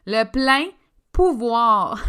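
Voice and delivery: loud, drawn-out declaration; emphatic. Real, not acted. Coach telling clients to take back power, loud and emphatic. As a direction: hammer it out slowly with force. Lands emphatically on the sought phrase.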